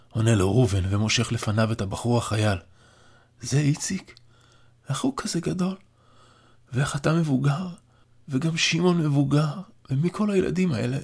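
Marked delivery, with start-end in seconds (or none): 3.4-11.0 put-on weak, higher, wondering old man; an old man barely able to believe what he sees; frail old father. A narrator's reading. Old father sees his grown sons and grandchildren, weak and higher. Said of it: "סטאשה שואל בקול חלוש".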